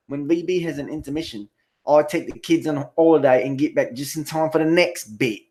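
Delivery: playful, exaggerated British impression; mock British accent. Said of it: "could that be any more fucking British".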